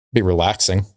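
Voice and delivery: ordinary talk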